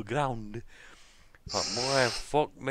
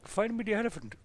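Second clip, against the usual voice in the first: put-on Indian accent; 10 semitones higher than usual. Prank caller boasting to the cookie lady, higher Indian accent. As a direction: boast about money in a thick Indian accent. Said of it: "I just said".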